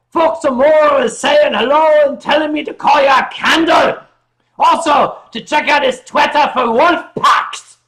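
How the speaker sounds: high, loud Scottish holler; screamed Scottish accent. Acted. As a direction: shout it out in a caricature Scottish accent. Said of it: "That's, that's Scottish. Scottish is not just screaming".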